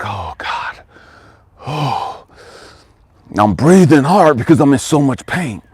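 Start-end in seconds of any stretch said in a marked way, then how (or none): 0.0-2.2 soft, low, airy exhale; retold sigh of relief to God, nearly voiceless; whispered relief. Acts out relief as pain eases.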